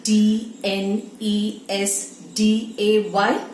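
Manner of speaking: slow letter-by-letter spelling; reading letters one by one; spelled out. Shown as a demonstration. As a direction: spell each letter out slowly. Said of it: "spelling out the word".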